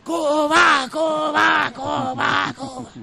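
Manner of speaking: high shouted chant fading on the last; multitud que grita. Acted. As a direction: imitate a crowd chanting back in unison. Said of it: "todos gritan". (coro de multitud a gritos)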